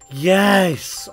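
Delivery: loud, high cheer of relief. Real, not acted. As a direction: celebrate like a goal scored. Patient survives onscreen.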